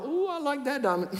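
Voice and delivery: high, amused covetous coo; greedy thief. Retold or imitated. Preacher voicing a thief eyeing heaven's walls, high and greedy. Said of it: "they be like".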